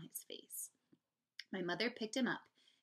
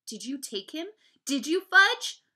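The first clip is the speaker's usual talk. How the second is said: rising demand, louder and higher on the second question; not so calm; 7 semitones higher, 14 dB louder than usual. A narrator's reading. Older brother questions Fudge about his turtle, not so calmly. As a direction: press the question harder, pitch climbing. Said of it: "and not so calmly".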